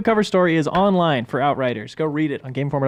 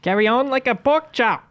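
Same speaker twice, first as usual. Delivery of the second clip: higher, broad Aussie read; Australian accent; 7 semitones higher than usual. Shown as a demonstration. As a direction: say it like a true Aussie. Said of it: "Australian accent".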